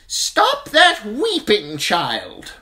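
high, loud, slow scolding matron; stern septa. A narrator's reading. Septa Mordane scolding the weeping girl, high and stern. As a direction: snap the girl out of tears. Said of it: "Septa Mordane said sternly".

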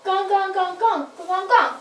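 a lightly odd honking puppet call. Pretend. Puppet Jesus calls to the boat in a honk. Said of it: "Jesus called out to them".

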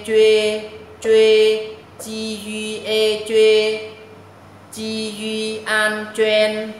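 very slow, drawn-out chant, punching the syllables loud; đánh vần chậm và to; slow loud spelling drill. Shown as a demonstration. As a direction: spell it out syllable by syllable for the class to repeat. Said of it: "mọi người đọc theo nè ha".

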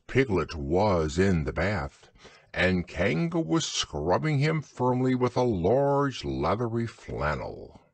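usual speaking voice

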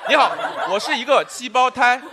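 normal talking voice

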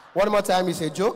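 usual speaking voice

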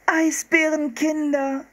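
put-on high, slow, delighted girl's call; delighted cry. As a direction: squeal with delight as Anne. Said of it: "rief Anne entzückt".

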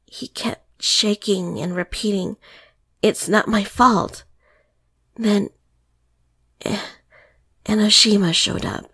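faltering, pause-filled telling; hesitant, broken account with long pauses; halting speech. Acted. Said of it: "in a faltering tone, with lots of pauses".

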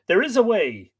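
hesitant admission, pitched high; doubtful. A narrator's reading. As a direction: offer it doubtfully, falling at the end.

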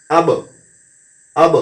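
আব with the ব released in a short bounce; bounced qalqalah. Shown as a demonstration. Teacher drilling qalqalah on ব, bounced release. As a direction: pop the ব of আব. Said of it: "ওরকম টেম দিতে হবে".

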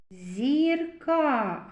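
зірка with the last a drawn out; stretched final a. Shown as a demonstration. Teacher picking out the last vowel, long a in зірка. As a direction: hold the ending a of зірка. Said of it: "А голосний".

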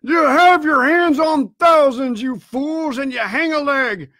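high, heated tirade, loud at first; raging exhortation. A narrator's reading. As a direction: berate the fools for hanging back.

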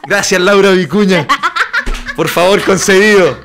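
loud, high exclaimed thanks to a saint; hammed-up grateful outburst; mock prayer of thanks. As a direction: cry out in mock-devout gratitude.

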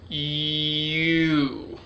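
"U" with a long y glide at the front; drawn-out "U", leaning on its opening y sound; emphasis on Y. Shown as a demonstration. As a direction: build up the y, then go into "U". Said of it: "you put emphasis on the Y".

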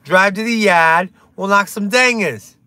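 hammed-up Boston accent, punched out; broad mock Boston accent. Shown as a demonstration. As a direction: do a quick bad Boston accent. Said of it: "I can do a Boston accent".

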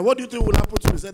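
ordinary delivery

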